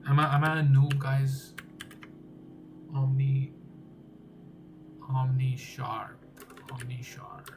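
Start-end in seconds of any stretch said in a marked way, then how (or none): none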